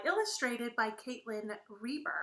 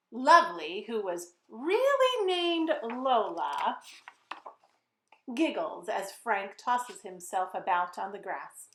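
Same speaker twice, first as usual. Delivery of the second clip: put-on lively, sing-song narration for kids; storybook read-aloud; 7 dB louder than usual. A narrator's reading. Author reading her book to children, lively and playful. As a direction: read it like story time for little ones.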